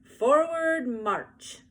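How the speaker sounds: high, loud, very slow parade call; loud drawn-out marching call. A narrator's reading. Calling the parade.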